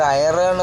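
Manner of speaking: "അയറേൺ" with the r fully sounded; spelling pronunciation. Shown as a demonstration. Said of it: "എന്ന് pronounce ചെയ്യണം". ("അയറേൺ" "റ" വ്യക്തമായി ഉച്ചരിച്ച്)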